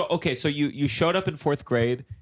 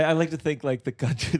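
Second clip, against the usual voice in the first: ordinary delivery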